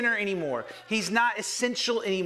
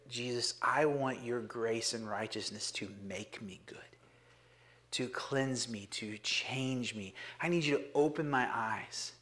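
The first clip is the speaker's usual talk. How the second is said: hushed prayer; 8 semitones lower, 6 dB quieter than usual. Acted. Preacher models a whispered prayer of surrender to Jesus. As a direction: pray it quietly, just to Jesus. Speaker prays quietly.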